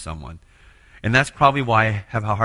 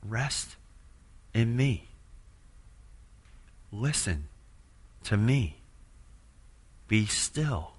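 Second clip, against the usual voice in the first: gentle voice of God; 8 dB quieter, slower than usual. Retold or imitated. God's voice heard in prayer.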